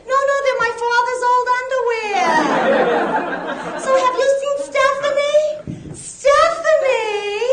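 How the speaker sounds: high, shrill-pitched gossip, stretched out; Long Island sophomore. Retold or imitated. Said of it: "my impression of two Boston University".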